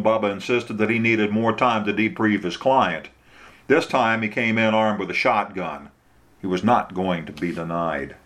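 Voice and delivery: ordinary delivery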